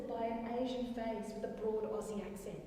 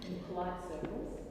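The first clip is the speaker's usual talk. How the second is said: lower, restrained delivery; politely understated; 5 semitones lower, 4 dB quieter than usual. Acted. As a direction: say it discreetly, pitched down. Contrasts polite with less polite.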